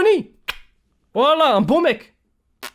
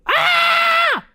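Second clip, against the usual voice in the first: high shout, yelled into the water; underwater scream; 3 dB louder than usual. Retold or imitated. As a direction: let out a wild yell under the water. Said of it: "T'as crié comme un con".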